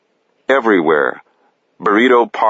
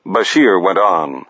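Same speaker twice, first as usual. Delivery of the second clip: ordinary delivery, nothing marked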